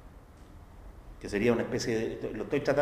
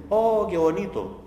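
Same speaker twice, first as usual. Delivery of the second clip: a slow, higher "Oh, qué bonito", pitched up in mock awe at the view; admiring sigh; 6 semitones higher, 4 dB louder, slower than usual. Lecturer imitating people at a mountain sunset, mock-awed. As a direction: gush over the sunset lazily.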